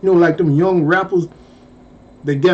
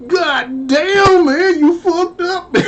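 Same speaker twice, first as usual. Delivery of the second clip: higher, half-crying mock sympathy; pitched-up teasing lament; mock pity; 9 semitones higher, slower than usual. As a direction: rub it in with fake sorrow. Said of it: "wanted to say so bad".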